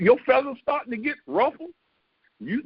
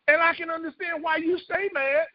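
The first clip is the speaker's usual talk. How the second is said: ordinary delivery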